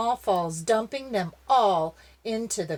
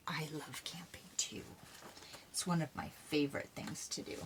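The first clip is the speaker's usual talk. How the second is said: whisper; 3 semitones lower, 13 dB quieter, faster than usual. Real, not acted. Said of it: "reader whispers own aside".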